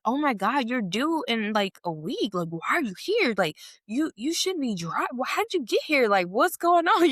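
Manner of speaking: mock panic, pitch rising toward the end; imitated freaking out. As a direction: act out an alarmed friend, building as you go. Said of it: "You know, she's freaking out".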